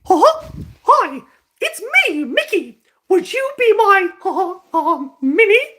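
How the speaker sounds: very high squeaky falsetto cartoon mouse; high-pitched Mickey voice, loud and giggly; Mickey Mouse impression. Shown as a demonstration. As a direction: squeak it out like the famous mouse, breaking into laughs. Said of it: "tell me what to say in Mickey Mouse's voice".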